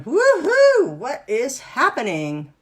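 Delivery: high, squealing wonder that swings up and down, with slow amazed questions; delighted brain. Retold or imitated. Instructor voicing the student's brain, delighted and amazed. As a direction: play the brain thrilled by something new, whooping at the start. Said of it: "Your brain's probably going".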